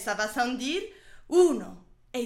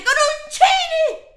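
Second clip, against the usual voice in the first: high shrill cry; 9 dB louder than usual. Acted. Sudden high loud word.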